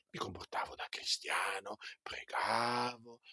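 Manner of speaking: hushed, voiceless repeat of the phrase; whisper. Shown as a demonstration. Preacher confiding to the congregation in a whisper. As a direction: drop to a secret whisper.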